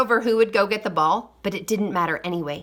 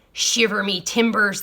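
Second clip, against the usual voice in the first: pirate voice. A narrator's reading. Pirate voice in story.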